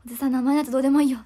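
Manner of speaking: stage line quote. Retold or imitated. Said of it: "とかも難しくない".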